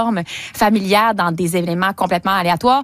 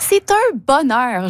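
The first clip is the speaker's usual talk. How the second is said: drawn-out, tongue-in-cheek rapture; slow, exaggerated bliss, meant ironically; ironic gushing; 3 semitones higher, much slower than usual. Real, not acted. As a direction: savour every syllable with mock delight. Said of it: "Vous êtes ironique".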